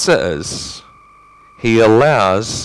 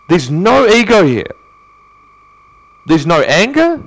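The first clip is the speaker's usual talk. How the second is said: ordinary talk, nothing marked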